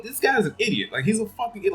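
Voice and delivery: exasperated outburst. Retold or imitated. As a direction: throw it up high, fed up with him. Reviewer's own exasperated reaction.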